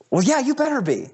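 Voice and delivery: squeaky high, cocky comeback; mock tough reply. To the apologizing bully, high cocky bravado. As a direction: puff up and sound tough, though the voice cracks high.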